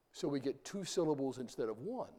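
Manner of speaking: usual speaking voice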